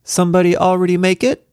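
put-on high, disappointed child voice; a small child, let down; little girl voice. A narrator's reading. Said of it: "she disappointedly asked".

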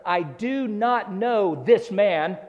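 slow, emphatic sworn denial; swearing denial. Acted. Peter denying Jesus to the bystanders, slow emphatic oath. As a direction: swear it word by word, slowly.

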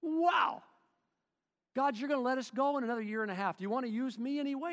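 high, loud, fast thrilled cry; excited. Acted. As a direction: gush to God with delight. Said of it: "He gets all excited".

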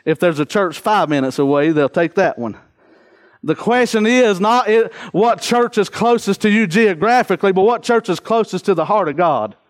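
ordinary talk, nothing marked

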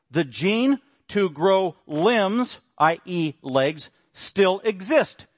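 very slow, weighty quotation; slow quote. Shown as a demonstration. Preacher reading the gene quote, very slow and deliberate. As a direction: lay each word down slowly. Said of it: "Furthermore, quote".

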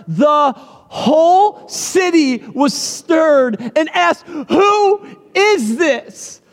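very slow, drawn-out question; dramatic scripture reading. Acted. Preacher reading Matthew aloud, slow and awed. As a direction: read it like the city's awe.

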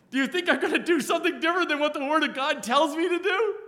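amused rhetorical jab; laughing retort. Real, not acted. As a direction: toss it back with a laugh. Preacher laughing at objection.

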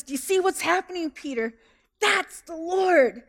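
grab his arm and tell him; urgent, awed whisper-shout to a friend; excited urging. Acted. John urging Peter.

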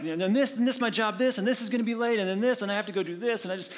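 high, fast, frazzled rant; retelling a frantic list of worries to a friend. Retold or imitated. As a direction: rattle off the list in a panic. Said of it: "and I was like".

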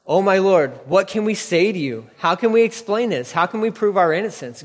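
anguished brother pleading with the lord; desperate plea. Acted. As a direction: beg for mercy you can't explain. Judah pleading before Joseph.

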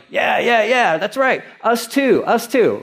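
high-pitched mock chorus of agreement; hammed-up eager me-too voice; eager followers chiming in. Voicing disciples eagerly joining in.

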